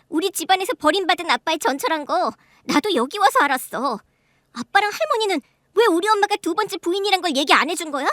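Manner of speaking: a touch of flaring indignation, pitch rising; indignant retort. Acted. Eldest daughter Luna flares up at her scolding father, indignant. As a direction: snap back at father and grandmother, aggrieved. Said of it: "발끈하며".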